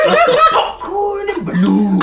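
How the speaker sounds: very high, loud clown greeting; sing-song creepy clown invitation; silly clown. Acted. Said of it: "I'm not tempted by clown voice".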